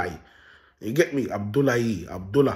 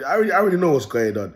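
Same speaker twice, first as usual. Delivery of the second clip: normal talking voice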